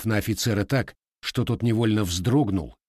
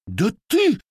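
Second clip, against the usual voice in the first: furious cry; 13 semitones higher, 6 dB louder than usual. Acted. Lieutenant leaps up furious.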